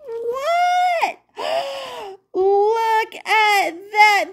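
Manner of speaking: very high cry of surprise; hammed-up astonishment for the kids; shocked. A narrator's reading. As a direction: gasp at the reveal. Big reveal of the rabbits.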